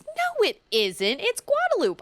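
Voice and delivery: high, slow, lightly voiced old lady insisting on "Guadalupe". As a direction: play a stubborn little old lady. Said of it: "And she goes".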